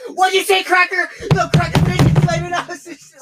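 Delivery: loud, high-pitched retelling of outrage; imitating an incensed shout; retold angry outburst. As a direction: act out their pissed-off yelling. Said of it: "they got fucking pissed".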